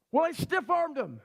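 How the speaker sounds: usual speaking voice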